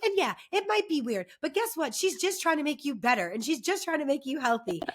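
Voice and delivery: high, insistent auntie lecture; retold pep talk. Retold lecture to cousin's kids.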